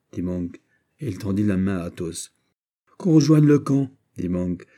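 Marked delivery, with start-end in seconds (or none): 3.0-3.9 loud, higher brisk command; commanding order. Acted. General orders his men back to camp, commanding. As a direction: issue the order with authority.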